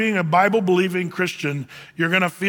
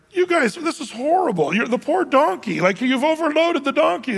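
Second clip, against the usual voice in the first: much higher, hammed-up outrage; indignant passerby; 8 semitones higher than usual. Stranger scolds father and son for overloading the donkey, high indignant. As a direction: scold them on the donkey's behalf.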